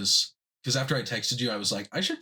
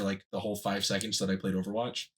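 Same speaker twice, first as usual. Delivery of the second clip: ordinary delivery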